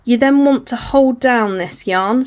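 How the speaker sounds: ordinary talk, nothing marked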